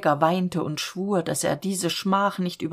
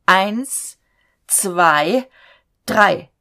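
loud countdown; 2 semitones higher, 8 dB louder, much slower than usual. A narrator's reading. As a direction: count each number out loud. Robber counts down threateningly.

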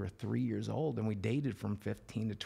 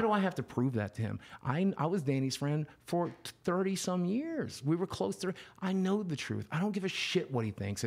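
indignant; 8 semitones higher than usual. Real, not acted. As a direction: defend yourself with heated disbelief. Indignant defence against accusers.

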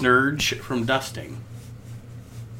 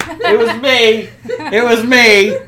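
laughing; 6 semitones higher, 8 dB louder than usual. Real, not acted. Laughing confession, taking the blame.

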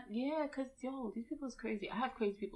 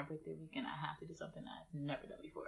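whisper; 2 semitones lower, 12 dB quieter than usual. Real, not acted. Whispered aside about birthday rule.

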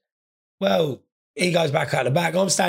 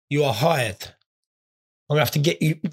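usual speaking voice

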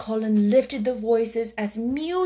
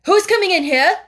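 startled, higher and louder; quick startled question; 9 semitones higher, 9 dB louder than usual. A narrator's reading. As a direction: blurt it out, startled. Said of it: "he said quickly".

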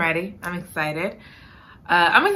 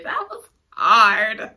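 ordinary talk, nothing marked

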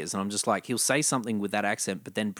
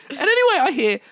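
laughing; 8 dB louder than usual. Real, not acted. Laughing after punching microphone.

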